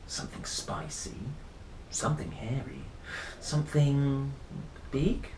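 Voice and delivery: scheming hush. Acted. Rory plotting the missing ingredient, in a soft hush. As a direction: savour each ingredient quietly.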